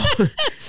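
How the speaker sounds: quick laugh jumping up high. Real, not acted. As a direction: break into a short high laugh. Laughing mid-story.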